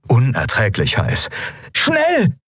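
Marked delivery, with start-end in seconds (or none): 1.7-2.4 high, urgent shout-like cry; sharp high-pitched urging. A narrator's reading. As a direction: hurry them along in alarm. Urgent order during a fire.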